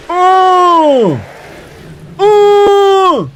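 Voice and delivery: long, very high, loud whoop; drawn-out shout of excitement; hyped "oh" yell. Real, not acted. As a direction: holler it out, held high. Reacting to big hits in game.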